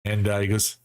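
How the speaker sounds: ordinary talk, nothing marked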